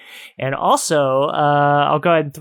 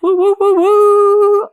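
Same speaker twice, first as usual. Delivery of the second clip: high, loud string of woos; excited whooping; 8 dB louder, slower than usual. Real, not acted. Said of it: "I'm excited about that".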